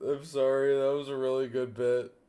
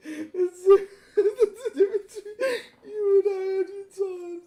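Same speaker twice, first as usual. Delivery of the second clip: laughing squeal; 5 dB louder than usual. Acted. Laughing through a character line.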